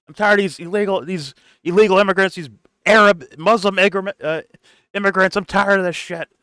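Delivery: high, loud, hammed-up ranting protester; mock angry rant. Streamer parodies the march leader's angry complaint. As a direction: shout it like a caricature of a marcher.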